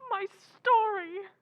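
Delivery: high, drawn-out tearful wail. Acted. Writer telling her sister about her story, tearful. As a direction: cry it out, slow and high. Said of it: "You're crying".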